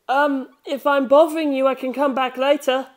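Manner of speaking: put-on a nervous boy interrupting politely; timid young voice.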